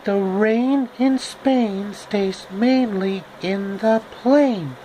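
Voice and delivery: Audrey Hepburn voice. Acted. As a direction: savour each vowel like an elocution drill.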